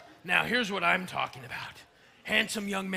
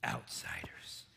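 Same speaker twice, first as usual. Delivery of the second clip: horrified whisper; 8 semitones lower, 10 dB quieter, slower than usual. Acted. Horrified at the idea.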